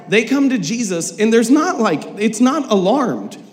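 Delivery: ordinary delivery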